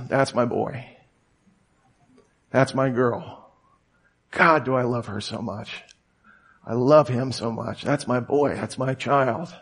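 softly voiced fatherly love; tender. God thinking about his child, tender and quiet. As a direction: say it low-key and tenderly, letting it settle.

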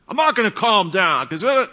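high, defiant shouting back; worked-up heckler cutting in; acted angry yell. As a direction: refuse loudly, voice shooting up. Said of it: "someone starts to yell really loud".

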